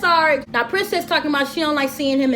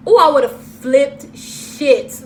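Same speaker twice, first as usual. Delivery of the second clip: drawn-out outrage; 5 dB louder, much slower than usual. Real, not acted. Outraged reaction.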